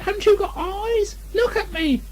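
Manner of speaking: a tiny pig trying to yell; Piglet's small outraged cry; shouted. Acted.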